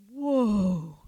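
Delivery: low, loud, drawn-out "Whoa"; awed whoa. Acted. Preacher voicing an amazed churchgoer, low drawn-out awe. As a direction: let a deep, amazed whoa roll out. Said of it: "and you're like".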